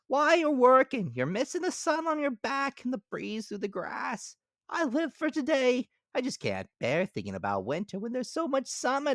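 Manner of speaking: high, loud cartoon grasshopper; bright piping insect voice. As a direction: squeak it out as the carefree grasshopper. Grasshopper character voice.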